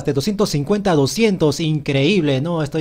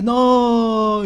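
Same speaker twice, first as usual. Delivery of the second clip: high, held-out mock refusal; imagined bear protesting; 7 semitones higher, 4 dB louder than usual. Gamer voicing the bear in a mock argument, protesting. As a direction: play a bear refusing in a raised voice.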